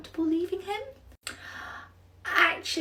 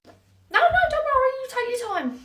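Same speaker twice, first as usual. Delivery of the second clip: sugary high voice; 13 semitones higher, 7 dB louder than usual. Acted. Sweetly covering for absent Ashley.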